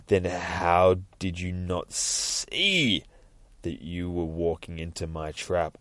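slow, stretched "see", dropping low; sinister reveal. Acted. Judge revealing the trap to Nightwing, slow menace. Villain springs his trap.

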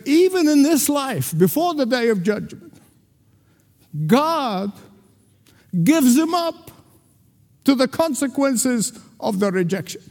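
high, rising pulpit cry; fervent sermon climax, pitched high; preaching at full force. Acted. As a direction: lift the quote up like a preacher at full stretch. Preaching swell on Paul's words.

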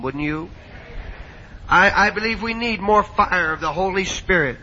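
ordinary talk

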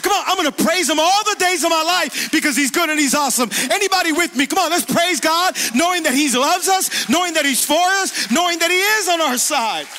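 loud, rousing preacher's cadence, building; full-force preaching. Acted. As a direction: preach it out to the whole church.

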